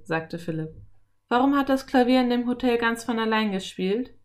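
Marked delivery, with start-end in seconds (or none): 1.3-4.1 put-on higher, louder boy's question; raised young voice. A narrator's reading. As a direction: ask as a curious boy. Boy asks about mysterious piano.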